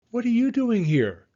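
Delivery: high, loud startled demand; surprised shout. Acted. Fire chief spots the children at the fire, high loud shout. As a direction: catch the kids red-handed.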